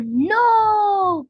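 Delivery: high, loud no; cried out. A narrator's reading. Patrick stopping SpongeBob from the doctor, loud cry. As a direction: shout to stop him. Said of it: "cried Patrick".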